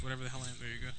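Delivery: normal talking voice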